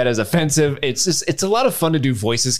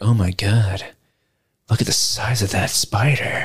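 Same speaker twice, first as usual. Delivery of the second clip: slow, drawn-out awe in a put-on voice; Vecna impression; 4 semitones lower, slower than usual. Podcast host imagining Vecna meeting the Mind Flayer, awestruck. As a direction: play an awestruck Vecna, drawing the words out. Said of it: "Vecna would probably get up there and be like".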